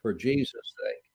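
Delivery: low, reverent prayer voice; closing prayer. Real, not acted. Preacher asks in Jesus' name before the sermon, prayerful.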